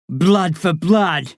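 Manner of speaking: very slow, solemn chant; solemn oath. A narrator's reading. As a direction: intone it like a warrior's vow. Ritual oath.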